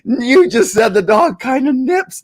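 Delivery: hammed-up panic at a charging dog; mock panic shout. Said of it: "and you're going".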